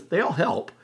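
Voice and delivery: ordinary delivery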